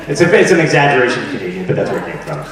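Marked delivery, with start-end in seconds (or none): none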